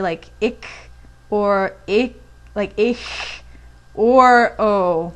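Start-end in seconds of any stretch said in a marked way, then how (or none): 0.4-0.7 ich said as a harsh throaty ach sound; wrong ich. Shown as a demonstration. Learner saying ich badly to students. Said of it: "A lot of people say". 1.9-2.2 ich with a rough k-like ending; wrong ich. Shown as a demonstration. Learner's wrong ich shown to students. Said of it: "A lot of people say". 2.8-3.4 ich with a clumsy wrong ch. Shown as a demonstration. Learner's botched ich shown to students. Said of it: "A lot of people say".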